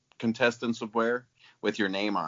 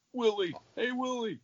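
Willie called in a high elf voice; elf impression; 14 semitones higher, 5 dB quieter than usual. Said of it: "That's my elf impression".

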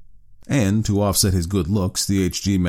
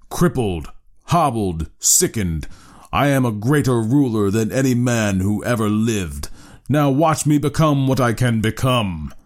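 bellowing; 5 semitones higher than usual. A narrator's reading. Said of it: "he bellowed".

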